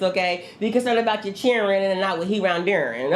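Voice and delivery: ordinary delivery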